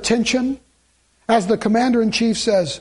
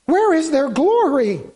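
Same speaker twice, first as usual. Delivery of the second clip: impassioned sermon question; preaching at full force; 7 semitones higher than usual. Acted. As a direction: cry out the question to the church. Preacher building to climax.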